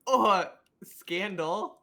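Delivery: mock outrage. Mock outrage at lost coin.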